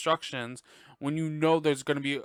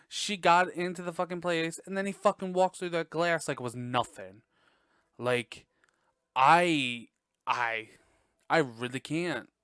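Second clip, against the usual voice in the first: ordinary delivery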